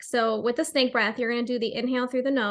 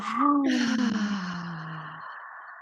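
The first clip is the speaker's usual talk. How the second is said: audible sigh; 3 semitones lower than usual. Shown as a demonstration. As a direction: sigh it all out. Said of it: "an exhale as a sigh".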